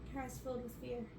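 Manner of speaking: ordinary delivery, nothing marked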